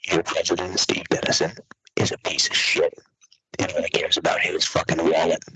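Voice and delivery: hushed, voiceless whisper of contempt; whispered insult. Real, not acted. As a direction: whisper it like a dirty secret. Insulting the president under breath.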